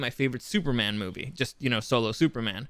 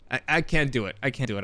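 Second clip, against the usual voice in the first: normal talking voice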